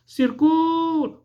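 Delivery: "Circule" chamado bem agudo, com o "CU" esticado; chamamento com sílaba tônica forte. Shown as a demonstration. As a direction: prolongar e elevar o "cu" de "Circule". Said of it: "pronunciado com maior intensidade". ("Circule" em chamamento lento, força na sílaba "cu")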